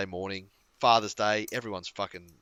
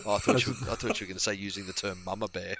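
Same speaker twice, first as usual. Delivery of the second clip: ordinary talk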